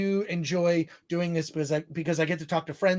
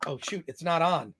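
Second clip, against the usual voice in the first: ordinary talk, nothing marked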